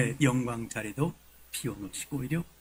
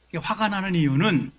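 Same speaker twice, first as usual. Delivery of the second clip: ordinary talk, nothing marked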